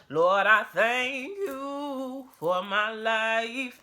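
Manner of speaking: slow drawn-out thanks, lifted high; grateful prayer. Real, not acted. Vlogger thanking God for her life, slow and high. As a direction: pray it slowly, up toward heaven.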